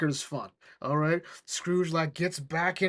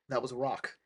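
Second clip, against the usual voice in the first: ordinary talk, nothing marked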